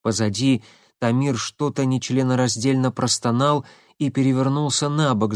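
ordinary delivery

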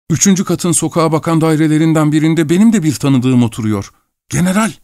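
high, excited voice of a startled man; agitated revelation. Acted. Startled by shared acquaintance in story.

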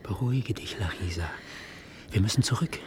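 sanft geflüstert zu ihr; flüstere ihr Ruhe zu; beruhigendes Flüstern. Acted. Beruhigt die verstörte Larisa. (zärtlich geflüsterter Trost)

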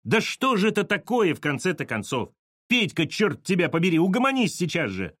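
high, exasperated scolding; desperate cry. A narrator's reading. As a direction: scold him at the end of your tether. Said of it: "крикнула Саша в отчаянии".

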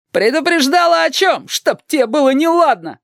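high-pitched exasperated interrogator; acted outburst of a furious cop; angry yelling. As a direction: yell at a stubborn witness, pitch shooting up. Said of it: "орал на неё блондин".

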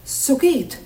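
normal talking voice

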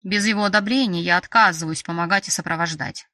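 ordinary delivery, nothing marked

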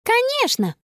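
high-pitched, carefree exclamation; breezy agreement. Acted. Girl chimes in with father, high breezy agreement. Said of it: "непринуждённо подхватила Конни".

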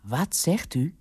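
mildly astonished query; understated surprise on the phone. A narrator's reading. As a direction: ask in disbelief. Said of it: "riep hij verbaasd".

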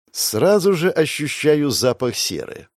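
usual speaking voice

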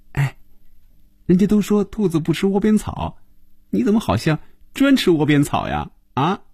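high, playful teasing; cheeky. A narrator's reading. Phoebe leaning in to tease Hongjun, cheeky and grinning. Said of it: "冲红军嬉皮笑脸地说".

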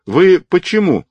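loud, high-pitched demand; stern challenge. A narrator's reading. Sergeant challenging the man over the patient, stern. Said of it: "строго окликнул его сержант".

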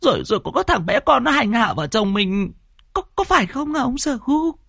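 put-on high-pitched frightened old wife; trembling. A narrator's reading. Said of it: "thì run run".

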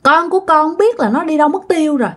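high, fast tearful begging; crying plea. Acted. Possessed woman crying to the monk about her lost child, high tearful plea. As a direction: beg the monk through tears, pitched high. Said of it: "bà ba bà mới khóc".

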